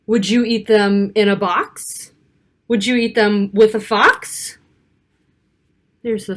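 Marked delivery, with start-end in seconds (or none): none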